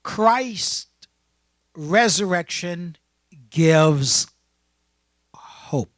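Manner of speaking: loud, word-by-word sermon theme; forceful start, dropping on the last word; preaching proclamation. Real, not acted. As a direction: proclaim it to the congregation, pausing after each word.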